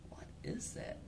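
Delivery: voiceless, curious whisper; whispered bear voice. Retold or imitated. Narrator voicing the bear, whispered curiosity. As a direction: hush it like a wary bear.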